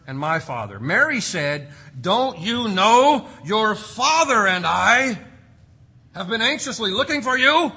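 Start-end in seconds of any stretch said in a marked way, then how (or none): 2.0-7.7 stern retold scolding. Preacher voicing Mary scolding her son, stern and loud. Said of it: "she probably didn't say it like that".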